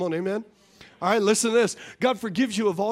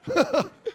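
laughing; 7 semitones higher than usual. Real, not acted. Laughs after the joke.

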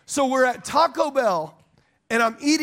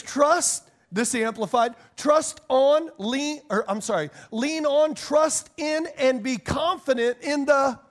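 scripture reading; 5 semitones higher than usual. Acted.

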